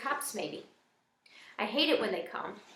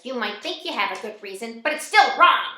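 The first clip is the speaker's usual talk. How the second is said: put-on nasty scolding old lady; mean old lady; 2 semitones higher, 10 dB louder than usual. A narrator's reading. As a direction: tell them off meanly, hitting the end hard. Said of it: "Her words sound even meaner than before".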